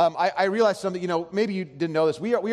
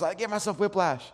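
mock the wife holding back tears; fighting pain and tears; 2 semitones higher than usual. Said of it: "she's fighting the pain and the tears".

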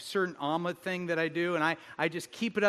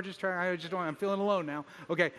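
normal talking voice

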